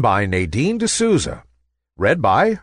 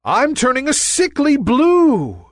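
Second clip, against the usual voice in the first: much higher, alarmed; frightened; 4 dB louder than usual. A narrator's reading. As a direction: panic at turning blue. Nimbus afraid of changing.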